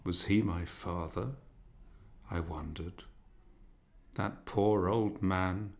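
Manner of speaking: usual speaking voice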